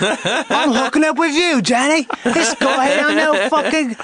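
hammed-up British thug accent; Guy Ritchie street tough. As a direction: threaten like a London film gangster. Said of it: "What about Guy Ritchie movie?".

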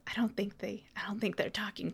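covert whisper; hushed aside. Acted. Would-be covert visitor to her firefly, hushed whisper. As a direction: whisper it, trying not to be noticed.

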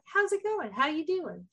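mock friendly phone greeting; casual chatty approach. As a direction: lightly imitate someone making chit-chat. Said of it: "the way he approaches it is like".

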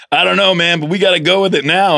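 lightly voiced weary colleague; imitating sound designer. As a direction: do the tired mixer, half-joking.

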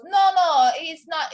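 put-on loud, high botched Puerto Rican accent; badly faked Puerto Rican wife, protesting loudly; wrong accent. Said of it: "I'm doing the wrong accent".

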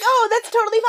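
put-on very high, airy girly voice; sugary high mock approval; mock sweet reassurance. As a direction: coo it like a fake-nice friend. Mock girls reassuring Hannah.

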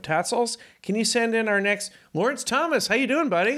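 high excited greeting. Real, not acted. Host calling out.

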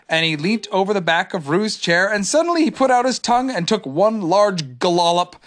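ordinary delivery

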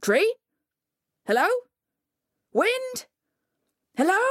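high, short questioning calls; tentative calling. A narrator's reading. Coyote trapped in the tree, high uncertain calling. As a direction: call out and wait for an answer.

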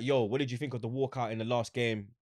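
ordinary talk

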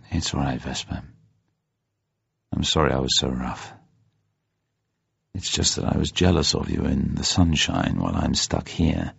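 low, soft apology; relenting, gentle. A narrator's reading. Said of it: "Bond at once relented".

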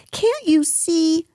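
high, slow mock shout; exasperated Piglet. A narrator's reading. Piglet shouts at Kanga to look, exasperated. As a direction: cry out in a small, high voice. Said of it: "shouted Piglet".

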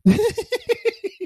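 bursting into a high laugh. Real, not acted. Laughs at her line.

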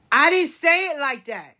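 put-on high, loud child's denial; indignant protest. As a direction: protest it like an accused little kid. Acted child's protest when confronted.

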